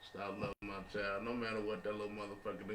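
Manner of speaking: normal talking voice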